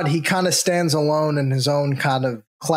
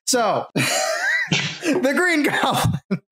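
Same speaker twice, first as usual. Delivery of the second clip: high, slow words broken by laughter; can barely get it out for laughing; laughing through words; 8 semitones higher, much slower than usual. Real, not acted. Host giggling about the Green Goblin's hair, laughing through it.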